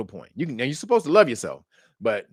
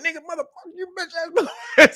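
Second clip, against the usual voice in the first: high, slowing cussing dissolving into laughter; laughing mock rant; 14 semitones higher than usual. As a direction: curse him out while cracking up. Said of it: "He was like".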